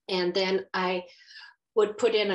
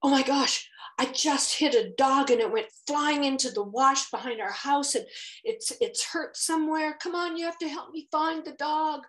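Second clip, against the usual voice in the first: panicked husband; 7 semitones higher than usual. Retold or imitated. Husband calling from his car window, higher and frantic. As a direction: act out his panic, pitch climbing. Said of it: "he was in this panic mode".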